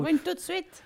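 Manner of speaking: mock scolding. Parent yelling at runaway kid.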